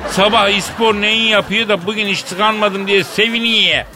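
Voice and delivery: put-on Tokat accent. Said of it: "Tokat şivesini kapmış".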